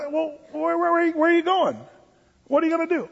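high, prying questions from a playful father; mock interrogating dad. Father stalling his teen with mock questions, high and prying. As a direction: stall them with nosy questions. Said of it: "sometimes I like".

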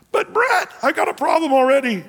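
high, quick voice of a puzzled listener; doubting congregant. Acted. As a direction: jump in as the skeptic in the pews. Congregant's imagined objection to pastor.

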